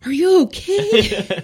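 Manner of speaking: very high, loud, drawn-out alarmed question; hammed-up frantic concern, stretched out; mock alarmed driver. Driver to child just hit by van, hammed-up alarm.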